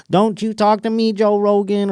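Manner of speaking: high, put-on indignant voice; mock Cat Williams telling him off; Cat Williams impression. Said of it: "Cat Williams was like".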